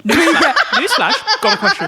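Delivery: high, loud mock newsflash; mocking announcer. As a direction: squeal it like a breaking headline. Mocking the question opener.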